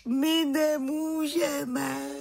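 high, drawn-out whine; wailing. Acted. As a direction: wail it slowly like a stuck kid. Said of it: "naříkal Pepe Kševců". (naříkavě, vysokým protáhlým hlasem)

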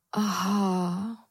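drawn-out, faltering aha at normal volume; taken aback. A narrator's reading. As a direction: let it out slowly, caught off guard. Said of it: "hlesla zaskočená Moja". (zaskočené, protáhlé hlesnutí)

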